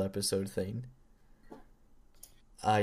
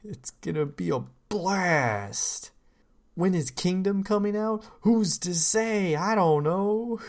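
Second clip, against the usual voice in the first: slow, high, louder goofy voice; silly clown; 8 semitones higher, 4 dB louder, slower than usual. Pretend. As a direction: stretch every word like a clown. Keeps the goofy voice going.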